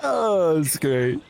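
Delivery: ordinary talk, nothing marked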